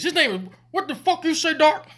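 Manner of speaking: high-pitched retold outrage; mock-shocked superior demanding a repeat; incredulous sergeant. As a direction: build to a loud, indignant question. First sergeant's shocked reply, acted.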